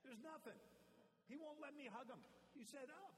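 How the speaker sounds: high whiny complaint; hammed-up high whiny grandpa; mock-exasperated grandpa. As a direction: plead with the toddler in mock outrage. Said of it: "I'm like".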